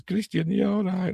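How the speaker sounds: high put-on child voice; little girl's voice. Girl checking on sleeping brother, high mock child voice. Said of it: "She says".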